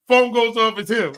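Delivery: ordinary talk, nothing marked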